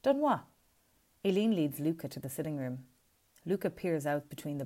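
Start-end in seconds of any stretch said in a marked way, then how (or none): 0.0-0.4 high, loud confused burst. A narrator's reading. Luca to his mother, loudly confused. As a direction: blurt it out baffled. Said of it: "Luca is confused".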